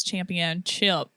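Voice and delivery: CHAMPIONSHIP slowly ending in "chip"; stubborn wrong pronunciation. Retold or imitated. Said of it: "And I'm like".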